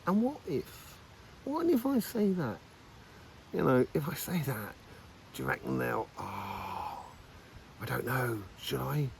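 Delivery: soft, hesitant self-questioning, trailing away; hesitant inner voice. Retold or imitated. Curious side wondering whether to say it, quietly unsure. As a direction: muse it quietly to yourself, unsure whether to speak. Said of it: "kinda go".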